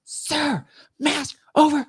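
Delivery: very high, strained imitated yelling; mock yell. TSA lady to passenger still staring at his bag, mock high yell. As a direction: yell it like an exasperated TSA officer. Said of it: "She, like, yells at me".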